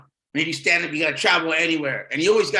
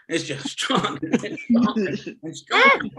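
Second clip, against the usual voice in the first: laughing; 6 semitones higher, 3 dB louder than usual. Real, not acted. Repeating the line laughing.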